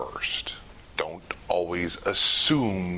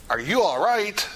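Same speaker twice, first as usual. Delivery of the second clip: high, brisk check-in; 10 semitones higher than usual. Shown as a demonstration. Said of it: "if I come in and go".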